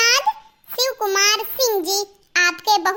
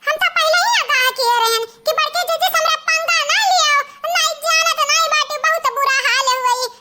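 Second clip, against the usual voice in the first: lightly voiced, raised-pitch village woman; gloating sister-in-law; 5 semitones higher than usual. In-law gloating over the elder sister-in-law's victim, lightly mocking. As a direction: play the in-law who warned everyone.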